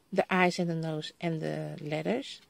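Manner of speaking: usual speaking voice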